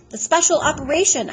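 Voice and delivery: high, loud insistence; upset insistence. A narrator's reading. Boy confronting the doctor about the operation, upset.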